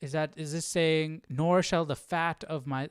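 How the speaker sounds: ordinary talk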